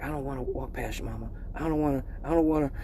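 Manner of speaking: mock-timid quiet plea; quiet mock-scared girlfriend; scared whisper. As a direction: whimper it like she's afraid to pass mom.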